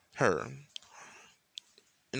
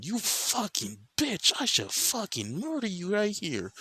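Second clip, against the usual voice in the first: high-pitched, drawn-out furious threat; acted rage, voice pitched way up; anger and rage; 11 semitones higher, slower than usual. Michael threatening Riser with murder, high-pitched rage. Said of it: "with fucking anger and rage".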